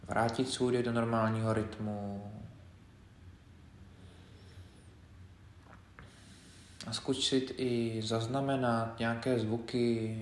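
quiet, unhurried meditation leader; guided meditation. Acted. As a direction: guide them gently through the body, leaving space between phrases. Leads a body scan meditation.

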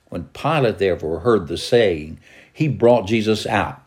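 plain reading voice

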